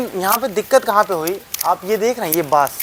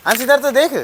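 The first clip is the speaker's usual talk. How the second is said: calling out; 7 semitones higher than usual. Real, not acted. Calling cameraman to turn.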